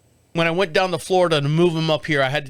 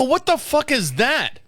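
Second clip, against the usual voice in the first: startled outburst; 8 semitones higher than usual. Retold or imitated. Startled by strange noise.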